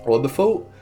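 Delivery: ordinary talk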